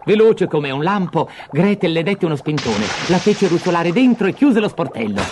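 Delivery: usual speaking voice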